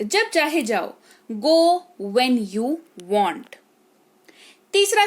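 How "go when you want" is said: very slow, careful teaching read; slow model sentence. Shown as a demonstration. Teacher modelling sentence slowly for the class. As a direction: say Go when you want word by word. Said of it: "teacher repeats model sentence".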